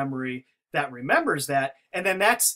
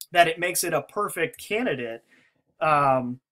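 normal talking voice

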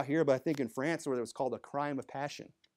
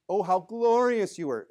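higher, louder mock admiration; sarcastic praise; 5 semitones higher, 6 dB louder than usual. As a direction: gush with cutting sarcasm. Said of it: "It's full of sarcasm".

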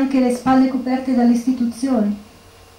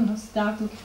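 bitter echo; 10 dB quieter than usual. Acted.

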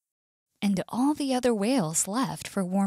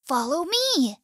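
higher, eager call; eager invitation; 7 semitones higher than usual. A narrator's reading. Bird inviting the whale into the sky, eager. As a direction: call them along, pitch lifted.

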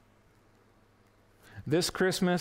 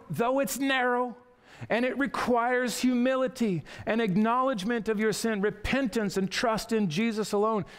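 raised, insistent pleading for the listeners; urgent preaching; 8 semitones higher than usual. Acted. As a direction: press the open door on them, earnestly. Preaching builds urgent appeal.

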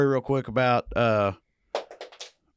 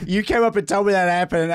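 ordinary delivery

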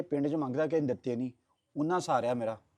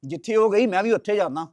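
loud, raised-voice threat; angry threat; 4 semitones higher, 7 dB louder than usual. Acted. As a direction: throw it at her in anger. Heated quarrel with mother.

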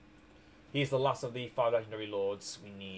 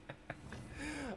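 high laugh; 13 semitones higher, 15 dB quieter than usual. Real, not acted. Laughs at own admission.

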